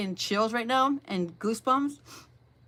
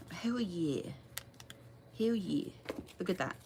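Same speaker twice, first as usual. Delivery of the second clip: hushed hell yeah; 3 semitones lower, 11 dB quieter than usual. Real, not acted. Reader reacts to drawn card.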